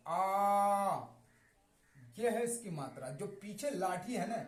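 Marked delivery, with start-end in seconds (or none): 0.1-1.1 आ held long and open; stretched aa. Shown as a demonstration. Teacher showing how to read आ, drawn out. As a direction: drag out the आ sound. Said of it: "इसे खच कर पढ़ा".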